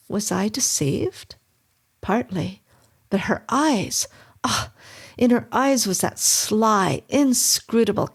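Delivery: ordinary delivery, nothing marked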